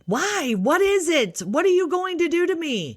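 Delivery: very high-pitched mock panic; panicky daughter. Daughter questions the tech about the scan, hammed-up panic. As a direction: squeal the questions like a spiraling teen. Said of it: "she spirals even harder".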